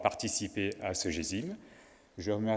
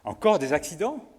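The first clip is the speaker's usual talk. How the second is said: surprised; 7 semitones higher, 6 dB louder than usual. Acted. Boss reacts in staged skit.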